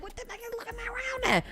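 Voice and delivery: high, quick, soft squeak of confusion; hushed, rapid, high-pitched query; retold puzzlement. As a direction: wonder aloud in a small high voice. Retold puzzlement before spotting rodents.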